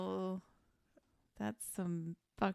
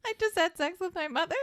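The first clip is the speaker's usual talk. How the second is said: much higher, fast gasp of realization; hammed-up disgusted shock; mock horrified disbelief; 10 semitones higher, faster than usual.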